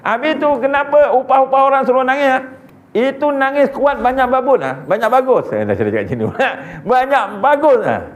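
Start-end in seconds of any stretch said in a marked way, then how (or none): 0.1-5.5 Chinese friend's pidgin. Retold or imitated. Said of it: "kata dia". 7.0-8.0 Chinese friend's pidgin. Retold or imitated. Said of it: "kata dia".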